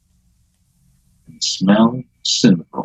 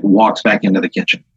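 ordinary talk

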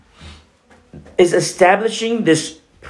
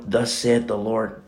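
lower, slow and solemn, a priest's pronouncement; solemn proclamation; 6 semitones lower, 6 dB quieter than usual. Retold or imitated. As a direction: intone it like an oracle to the crowd. High priest addresses the congregation.

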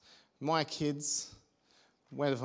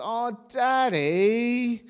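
put-on drawn-out, much higher and louder eye-roll groan of a boy; son's groaning "Oh, Daddy"; 8 semitones higher, 7 dB louder, much slower than usual. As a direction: stretch it slowly like a teased kid rolling his eyes. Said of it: "give a little bit of a tease and goes".

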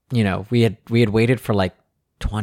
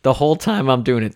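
ordinary talk, nothing marked

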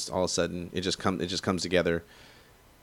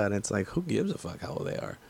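ordinary delivery

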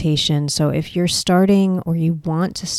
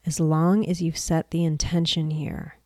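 softer, unhurried meditation voice with long pauses; guided meditation; 5 dB quieter than usual. Acted. Mentor leading listeners into a creativity meditation, guided meditation voice. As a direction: guide them gently through breath and stillness, leaving space between phrases.

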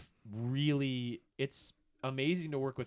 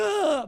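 a high, loud burst of a scream; silent fangirl scream; 11 dB louder than usual. Retold or imitated. Recalling meeting his hero, squeals in delight. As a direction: let out a thrilled little shriek. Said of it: "I'm just screaming".

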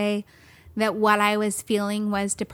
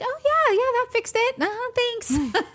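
much higher, sarcastic thanks; 14 semitones higher than usual. Retold or imitated. She mocks her own sarcastic reply to friends, high sarcastic. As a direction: drip with fake gratitude. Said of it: "And I was like".

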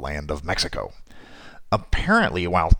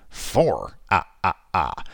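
vampire count voice; 2 semitones lower, slower than usual. Acted. Counting vampire facts, Count style.

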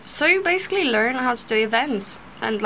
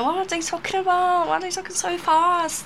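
higher-pitched, bewildered retelling; retold shock; 7 semitones higher than usual. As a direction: recall being lost among fast talkers. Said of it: "I was just shocked".

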